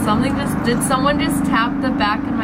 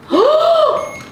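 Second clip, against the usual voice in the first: sharp gasp; 5 dB louder than usual. Real, not acted. Rem pod goes off.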